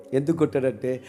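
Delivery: normal talking voice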